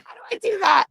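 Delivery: high, loud shouted version of her own panic; mock wail. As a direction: yell the question laughing at herself. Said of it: "She said".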